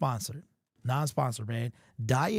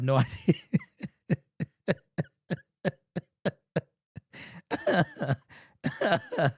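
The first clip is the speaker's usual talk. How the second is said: laughing through words; 6 semitones higher, much slower than usual. Real, not acted. Laughing at his own voice.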